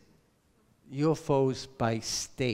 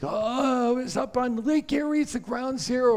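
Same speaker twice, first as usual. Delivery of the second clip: high mocking voice of people who assume; mock caller; 11 semitones higher, 3 dB louder than usual. Imitating people who call in, high and mocking. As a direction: raise your voice into a silly know-it-all.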